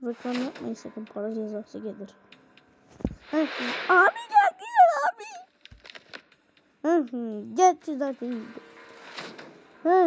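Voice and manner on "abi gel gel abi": high, loud call to come over. Real, not acted. Calling a teammate in the game, high and loud. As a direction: yell for him to come, pitched way up.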